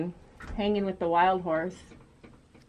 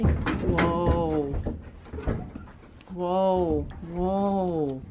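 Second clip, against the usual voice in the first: calming whoa; 3 semitones lower, 4 dB quieter, much slower than usual. Real, not acted. Calming a bolting horse.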